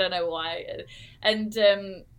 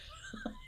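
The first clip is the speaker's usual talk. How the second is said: short laugh; 13 dB quieter than usual. Real, not acted. Laugh after retold refusal.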